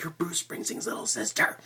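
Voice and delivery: hushed, awed whisper; starstruck whisper. Pretend. Fan whispering in awe about a star's sister. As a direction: breathe it out in awe.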